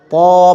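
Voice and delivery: "पौ" as a heavy emphatic T with long rounded vowel; thick-sound demo. Shown as a demonstration. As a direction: hold "पौ" full and level. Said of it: "मोटा करके". ("पौ" को मोटा करके खींचें)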